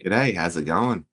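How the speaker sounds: slow, put-on Australian drawl; mock Australian greeting, hammed up; Australian accent. Greeting his co-hosts in a mock Australian accent. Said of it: "that's my best Australian accent".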